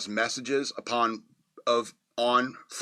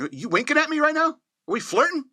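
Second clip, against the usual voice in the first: much higher, playful flirty movie line; quoting the movie. Retold or imitated. Reviewer quoting the heroine's flirty line, much higher and playful. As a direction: tease him like the heroine flirting. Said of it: "I'm quoting the movie already".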